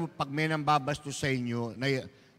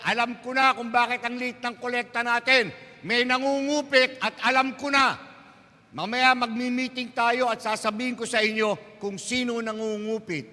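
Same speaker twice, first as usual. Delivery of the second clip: raised, commanding priest voice; stern priest; 7 semitones higher, 3 dB louder than usual. Acted. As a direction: announce it like a scolding parish priest. Priest announcing thief to congregation. (istriktong pari, malakas at mataas)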